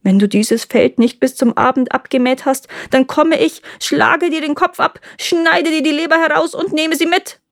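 mounting threat, pitch climbing high; acted retelling of a dire threat; retold threat. A narrator's reading. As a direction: build the warning to a high pitch. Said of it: "hat mir gedroht".

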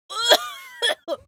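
high laughing wail; pained laugh-cry. Real, not acted. Throat burning from shot.

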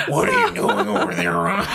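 put-on raspy chain-smoker kid voice, laughing; smoker's voice. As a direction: rasp it out like a two-pack-a-day smoker. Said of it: "sounds like he smokes two packs a day".

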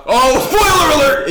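loud laughing high yelp; mock outrage with a laugh; shrieking at the spoiler; spoiler alert outcry. Real, not acted. Cohost reveals movie ending.

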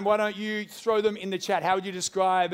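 usual speaking voice

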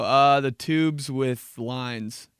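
slow, hesitant guess; unsure kid. Retold or imitated. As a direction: drag it out, clueless. Kid fumbling pasta name.

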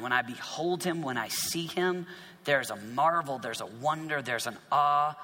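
ordinary talk

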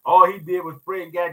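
usual speaking voice

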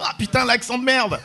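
high-pitched mocking outburst; exclaiming French spectator. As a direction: imitate an amused French heckler, pitched high. Mimics French audience mocking accent.

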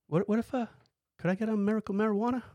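high, airy mock-innocent question; mock innocent ask. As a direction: play dumb and sweet, voice way up. Asking doctor for marijuana, innocently.

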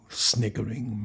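ordinary delivery, nothing marked

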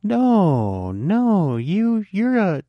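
overdone no, no, you're a; mock disingenuous reassurance. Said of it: "that sounded more disingenuous than I wanted".

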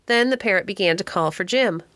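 ordinary delivery

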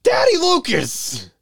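desperate yell. Acted. Actor urgently pleads with the director to continue, desperate yell. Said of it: "Please don't yell that".

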